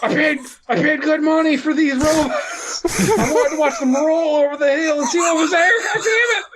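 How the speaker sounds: very high, wailing indignation; hammed-up fan outrage. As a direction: build to a squealed mock tantrum. Acting the cheated fan.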